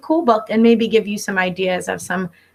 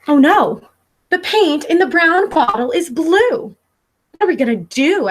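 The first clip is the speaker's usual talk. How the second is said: higher, louder mock panic; storybook alarm; 7 semitones higher, 4 dB louder than usual. Callie discovering the blue paint, hammed-up worried cry. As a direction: play up the little crisis for the kids.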